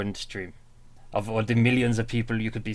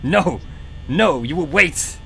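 shouting; 4 semitones higher, 6 dB louder, slower than usual. Real, not acted. Yelling at game character.

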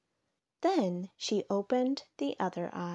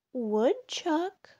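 higher, mild storybook call; 8 semitones higher than usual. A narrator's reading. Turtle calls Woodchuck on the hill, calling out. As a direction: call out a name, pitched up. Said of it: "called Turtle".

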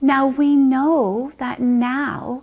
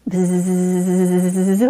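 buzzing bee; 5 semitones lower, 4 dB louder than usual. Shown as a demonstration. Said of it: "make a buzzing sound".

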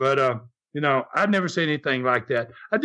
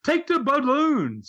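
crowd urging; 10 semitones higher than usual. Retold or imitated. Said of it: "So then they say".

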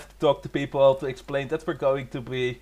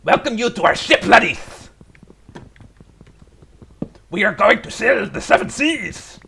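hammed-up pirate captain, louder; pirate accent; 3 semitones higher, 6 dB louder than usual. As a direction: hail the crew from the deck. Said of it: "that's about how far I can take the accent".

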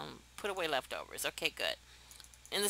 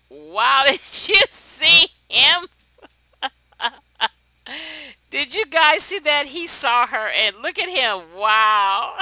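loud, high, laughing through the words; cracking up at the screen; delighted loud laughter; laughing disbelief; 6 semitones higher, 14 dB louder, slower than usual. Real, not acted. Laughing at Sim's reaction.

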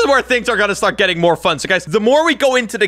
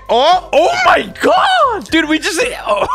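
shocked shriek; 12 semitones higher, slower than usual. Real, not acted. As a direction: shriek in disbelief then laugh. Ball gets hit, shrieks.

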